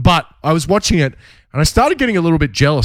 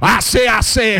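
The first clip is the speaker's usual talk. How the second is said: Foghorn Leghorn; 8 semitones higher than usual. Retold or imitated. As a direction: crow it like a blustering cartoon rooster. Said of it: "all Foghorn Leghorn".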